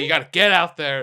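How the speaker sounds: loud pep-talk urging through a laugh; coach's pep talk. Retold or imitated. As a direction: lightly mimic a coach rallying a player. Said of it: "like, pep talk".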